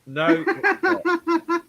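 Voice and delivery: high, drawn-out protest; mock-indignant denial. Real, not acted. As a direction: protest slowly in a high-pitched voice. Playful argument over Marmite.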